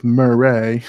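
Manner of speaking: "Murray" said as "Muh-ay", the r dropped; "Murray" with the r swallowed, a playful mispronunciation; odd pronunciation. Shown as a demonstration. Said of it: "I'm just gonna say it that way".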